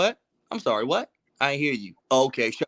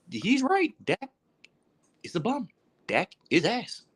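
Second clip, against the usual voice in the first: high, squeaky admission with pauses; high-pitched concession; 11 semitones higher, 3 dB quieter than usual. Real, not acted. Fan admitting the critic was right, high-pitched concession.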